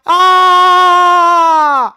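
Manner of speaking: long, loud, high "aah"; acted cry of pain. Teacher plays barefoot child stuck by a thorn, drawn-out yell. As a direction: yell out as if a thorn went into the foot. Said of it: "आपके मुंह से निकला क्या?".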